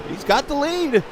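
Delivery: building from high to very high and loud; excited commentator. Real, not acted. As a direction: call the last lap with rising excitement. Live race call of the finish.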